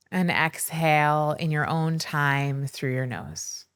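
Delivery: slow, measured meditation voice with long pauses; unhurried, soothing breathwork narration; guided meditation. Acted. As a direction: guide listeners gently into stillness, leaving space between phrases.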